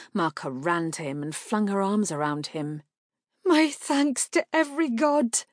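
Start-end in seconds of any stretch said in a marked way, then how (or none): 3.4-5.4 high, loud quavering relief; overwrought, tearful relief; on the edge of sobs. A narrator's reading. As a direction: cry out thanks, close to tears. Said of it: "Her voice quavered on the edge of sobs".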